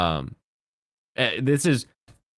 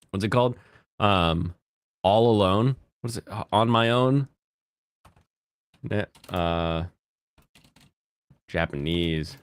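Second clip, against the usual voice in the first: ordinary talk, nothing marked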